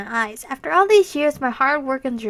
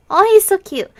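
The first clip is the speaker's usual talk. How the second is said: higher and louder, squealing over him; gushing; 7 semitones higher, 11 dB louder than usual. Real, not acted. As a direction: fangirl gush at the screen.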